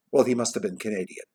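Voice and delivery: plain reading voice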